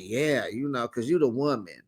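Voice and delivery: a lightly voiced husband, low and offhand; imitating the husband. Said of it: "And he like".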